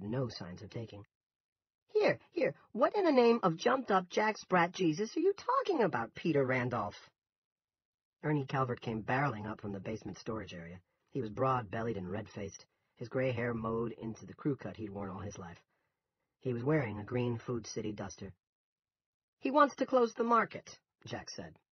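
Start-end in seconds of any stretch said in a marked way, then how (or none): 1.9-7.1 loud, high-pitched indignant outburst; blustering, incredulous shout; outraged. A narrator's reading. Angry grocer bursts into the dispute. 19.4-20.8 high, loud indignation; pitched-up, agitated appeal; upset complaint. A narrator's reading. Manager appeals to his colleague.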